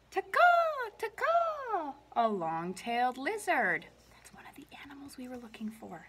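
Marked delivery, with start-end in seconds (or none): 1.0-1.9 high chirped taka; high squeaky lizard chirp; lizard call. A narrator's reading. 4.2-6.1 quick hushed whisper; whispered aside. Real, not acted. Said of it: "aside to children".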